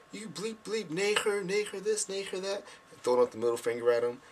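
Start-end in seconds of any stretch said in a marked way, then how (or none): 0.1-2.6 much higher mocking chant of the crowd; mock fan taunts. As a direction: imitate jeering soccer fans. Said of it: "they're like".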